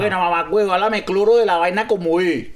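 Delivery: hammed-up Venezuelan slang banter; Venezuelan malandro. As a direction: play an excited malandro. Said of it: "vamos a tener un diálogo aquí entre malandros".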